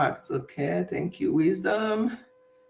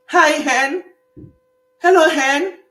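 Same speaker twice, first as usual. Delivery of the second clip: high, loud mock greeting; imitating a loud, high hello; called-out greeting; 9 semitones higher, 8 dB louder, slower than usual. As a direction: hail someone cheerily, hammed up. Acting out Scots greeting women.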